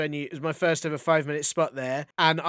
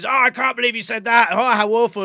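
higher, louder exaggerated outrage; mock shock; 5 semitones higher, 6 dB louder, faster than usual. Comic to a six-year-old, hammed-up mock horror. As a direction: ham up being scandalised. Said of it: "make a big deal of it".

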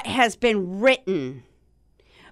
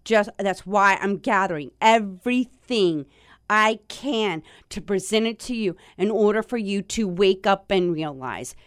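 ordinary talk

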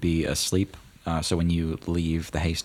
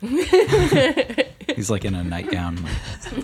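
laughing through words; 5 dB louder, much slower than usual. Real, not acted. GM cracks up at player.

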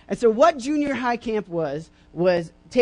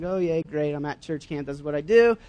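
sarcastic; 7 semitones lower than usual. Retold or imitated. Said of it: "and it's like".

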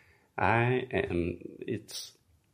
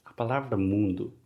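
usual speaking voice

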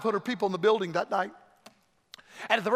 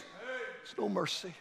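hushed warning; 14 dB quieter, much slower than usual. Real, not acted. Preacher repeating the warning to the congregation, hushed. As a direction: let it fall almost to nothing.

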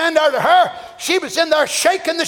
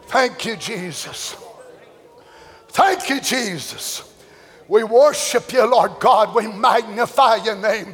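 lower, slow murmured praise; worshipful prayer; 4 semitones lower, slower than usual. Acted. Preacher praying to God after the shouting, low and slow.

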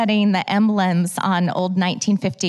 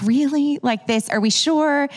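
higher, doubting questions to God; incredulous questioning; 7 semitones higher than usual. Retold or imitated. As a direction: push back in disbelief, pitch up. Arguing with God in prayer.

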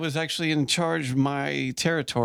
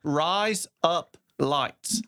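"Rise, up, lights" word by word in an Australian accent; slowly spaced Australian demo; Aussie accent; 3 semitones higher, much slower than usual. Shown as a demonstration. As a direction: demonstrate Aussie for a learner. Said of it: "in your best Aussie accent".

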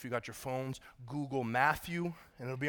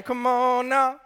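loud, high preacher's call; revved-up shout from the pulpit; rousing call; 9 semitones higher, 11 dB louder, much slower than usual. Real, not acted. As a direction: rouse the congregation with a raised shout. Rousing the congregation after amen.

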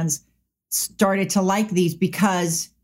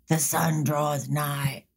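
"The sun draws nigh" spoken slow, low and softer, lightly solemn; imitating Honest's delivery; 6 semitones lower, 4 dB quieter, slower than usual. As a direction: drag "The sun draws nigh" out low and quiet like a brooding vampire. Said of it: "he says".

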